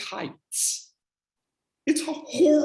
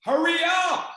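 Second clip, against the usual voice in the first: mock shout up; 8 semitones higher than usual. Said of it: "they're all downstairs going".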